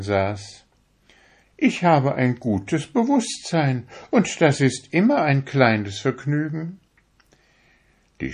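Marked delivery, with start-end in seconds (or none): 1.6-6.8 high, proud little needle voice; a needle showing off how fine it is; vain darning needle. A narrator's reading. To the fingers, a vain needle in a high proud voice. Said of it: "sagte sie".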